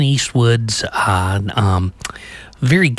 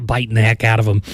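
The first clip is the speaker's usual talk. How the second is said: ordinary talk, nothing marked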